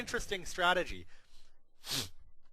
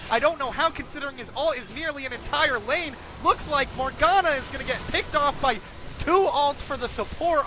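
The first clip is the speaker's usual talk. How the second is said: high, loud esports caster; rising hype over the final push; excited play-by-play; 6 semitones higher, 5 dB louder than usual. Acted. Late-game fight, high pitch.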